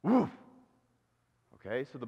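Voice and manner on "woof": short high-pitched "woof"; quick dog woof; dog bark. Acted. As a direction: imitate a dog's single bark.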